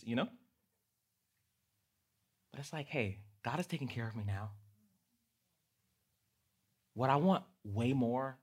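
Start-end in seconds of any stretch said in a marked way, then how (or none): none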